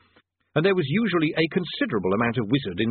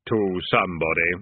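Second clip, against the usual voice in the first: grim reaper voice; 4 semitones lower than usual. A narrator's reading. Death speaks.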